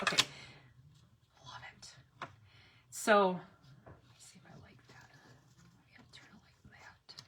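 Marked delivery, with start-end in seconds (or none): 1.5-7.2 soft whispered murmur; whispering to herself as she arranges. Real, not acted. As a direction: whisper to yourself while adjusting. Murmuring while adjusting decor.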